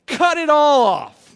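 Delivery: slow and loud, hammering each word; blunt loud demand. Retold or imitated. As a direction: say it like Paul losing patience. Paul quoted, blunt demand.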